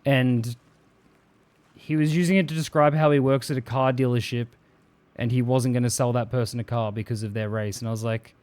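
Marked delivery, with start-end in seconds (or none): none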